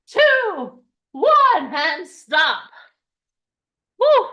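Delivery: high, loud coach calls; hyped countdown. Real, not acted. Fitness host cheering the room on, loud and high. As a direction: pump up the crowd.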